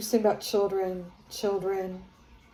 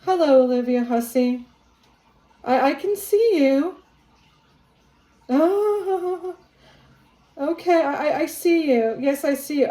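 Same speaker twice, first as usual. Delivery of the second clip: higher, louder, thrilled hello to a spirit; excited greeting; 5 semitones higher, 6 dB louder than usual. Real, not acted. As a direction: call out eagerly to someone unseen.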